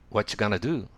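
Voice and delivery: "what you" blended into "whatcha" and "going to" into "gonna"; quick slangy "what you gonna do"; casual "whatcha gonna". Shown as a demonstration. Teacher modelling the casual street form of the question. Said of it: "キャジュアルに発音する場合は".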